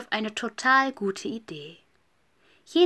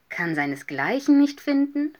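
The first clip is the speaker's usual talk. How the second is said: higher, louder incredulous echo; astonished question; 7 semitones higher, 5 dB louder than usual. A narrator's reading. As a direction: repeat it back as an amazed question. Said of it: "fragten die anderen erstaunt". (erstaunte Nachfrage)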